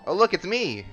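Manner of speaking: usual speaking voice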